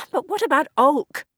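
high, worried cry; panicked about the friend left behind; alarmed question. Acted.

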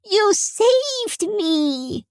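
put-on very high small-boy squeal, drawn out slowly; piping, stretched-out child's cheer; little child. A narrator's reading. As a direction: gush to your rescuer like a little kid. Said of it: "Little Toby crawled out".

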